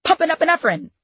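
high-pitched medical call, lightly yelled; mock yell. Actress demonstrating her hospital drama line, mock yell. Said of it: "having to yell".

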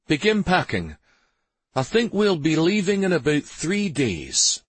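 lightly voiced master, pitched high; decisive master of house. A narrator's reading. Master instructing servants before a trip, decisive.

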